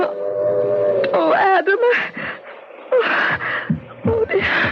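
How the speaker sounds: high, breaking cry of alarm; wife discovering her husband won't wake, shocked fear; frightened gasp. Acted. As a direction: realise something is terribly wrong.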